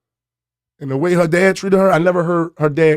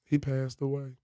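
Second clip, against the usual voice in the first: much softer, after a long pause; quiet retold news; 2 semitones lower, 14 dB quieter than usual. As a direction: say it softly, as mom breaking a death. Mom gently breaks the death news.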